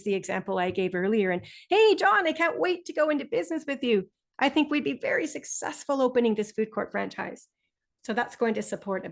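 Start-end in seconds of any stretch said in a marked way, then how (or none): 1.7-7.5 enthusiastic pitch. Shown as a demonstration. Teacher modelling an enthusiastic student pitching to his friend. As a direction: really go for it, bursting with eagerness. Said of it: "If he wanted to really go for it".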